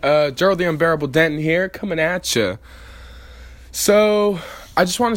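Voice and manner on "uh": ordinary talk, nothing marked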